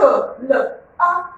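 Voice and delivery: usual speaking voice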